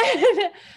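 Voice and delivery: high, loud burst of laughter; big laugh. Real, not acted. Laughing at her own dorm-room confusion, a loud high laugh. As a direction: break into a real laugh.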